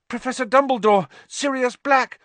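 high, loud urgent outburst; urgent cry. Acted. Harry urgently interrupting the headmaster, high and loud. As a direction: blurt it out in alarm.

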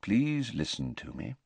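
soft, calm request; quiet, patient headmaster; quiet plea. A narrator's reading. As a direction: settle the boy gently. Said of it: "said Dumbledore quietly".

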